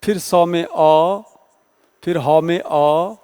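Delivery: each letter set apart, slowly; slow spelling out. Shown as a demonstration. Teacher to students, splitting the word into letters slowly. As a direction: break the word into sounds one by one, slowly. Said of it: "यदि सहस्त्र को तोड़ा जाए".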